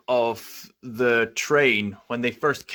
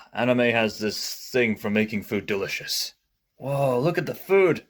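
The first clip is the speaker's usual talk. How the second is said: usual speaking voice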